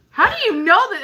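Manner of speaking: high, loud incredulous question; mock disbelief. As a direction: challenge her guess in playful amazement. Retells challenging friend's movie guess.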